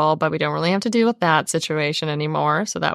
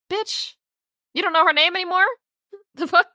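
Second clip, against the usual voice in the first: much higher, disbelieving outburst; ranting at a silly book line, pitched way up in disbelief; incredulous outburst; 11 semitones higher than usual. Real, not acted. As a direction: squeal it in disbelief, way up high.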